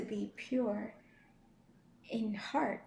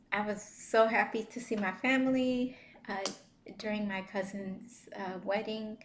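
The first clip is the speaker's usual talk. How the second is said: ordinary talk, nothing marked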